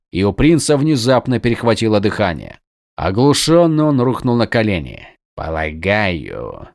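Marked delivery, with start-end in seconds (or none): 5.4-6.7 low, slow menacing growl. A narrator's reading. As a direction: growl it out like a killer. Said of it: "прорычал Шинец".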